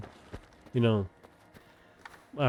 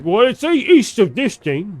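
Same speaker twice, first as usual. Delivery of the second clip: high, loud, slow mock voice of a smug arguer; mocking quote; 9 semitones higher, 9 dB louder, slower than usual. Mocking people with weak arguments, high, loud and slow. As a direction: drag it out like a dim know-it-all.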